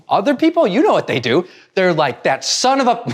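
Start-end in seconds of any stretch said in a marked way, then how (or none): 2.2-3.0 pitched-up fake outrage at a driver; half-hearted road rage, cut off mid-curse; mock angry driver. Imitating an angry driver cut off in traffic, mock outrage. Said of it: "they get completely angry".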